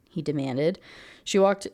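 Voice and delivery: usual speaking voice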